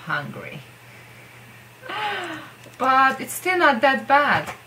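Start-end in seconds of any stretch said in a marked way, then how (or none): 1.8-2.5 quick high gasp of mock shock; a short theatrical intake of breath; sharp gasp. Real, not acted. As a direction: gasp as if scandalized. Mock shock at funny country name.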